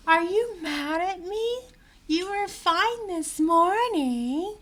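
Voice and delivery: high, slow mock-innocent whine; mocking Amber. Vlogger mimicking Amber's plea to Johnny, high and whiny. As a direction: mimic her with sugary fake hurt. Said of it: "she goes".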